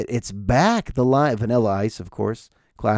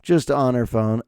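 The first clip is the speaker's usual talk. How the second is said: ordinary talk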